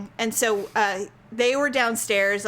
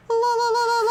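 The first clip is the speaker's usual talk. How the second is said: mock singsong; 10 semitones higher, faster than usual. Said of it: "she's like".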